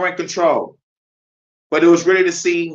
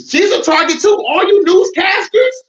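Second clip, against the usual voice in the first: shout; 6 dB louder than usual. Real, not acted. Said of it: "addresses newscasters".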